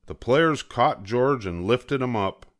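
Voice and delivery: usual speaking voice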